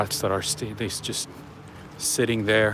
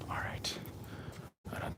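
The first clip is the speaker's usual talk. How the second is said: whispering; 19 dB quieter than usual. Real, not acted. Whispered aside while filming.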